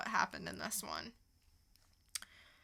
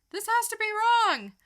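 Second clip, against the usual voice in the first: rising disbelief; 12 semitones higher, 7 dB louder than usual. Retold or imitated. Incredulous reaction to scene.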